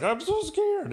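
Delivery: squeaky high mock fright; hammed-up girl frozen in fear; mock scared. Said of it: "she just freezes, like".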